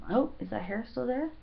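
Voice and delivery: ordinary talk, nothing marked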